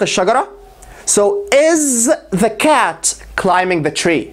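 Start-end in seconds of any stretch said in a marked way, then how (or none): 1.5-4.2 slow and clear, lean on is; slow model question. Shown as a demonstration. Teacher models the question slowly for students. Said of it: "slow model question".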